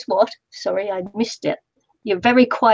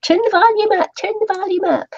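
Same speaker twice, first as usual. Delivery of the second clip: much higher, louder mock plea; mock complaint; 11 semitones higher, 3 dB louder than usual. Teasing friend imitating viewers' requests, high mock whine. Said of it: "your little quiet voice".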